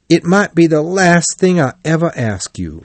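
plain reading voice

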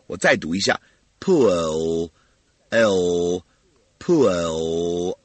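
"pull" slow, tongue tip held on the gum ridge for L; stressed L sound. Shown as a demonstration. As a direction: draw out the L of "pull". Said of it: "用舌尖舔住上齿龈".

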